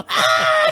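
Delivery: high-pitched imitation scream; mock scream. Recapping the screaming that ends the audio log, mock scream. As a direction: squeal out a brief pretend scream. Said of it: "just a bunch of screaming happens".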